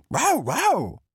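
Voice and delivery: high-pitched happy dog yaps; Fudge. A narrator's reading. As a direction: yap like an excited bulldog joining in. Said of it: "added Fudge".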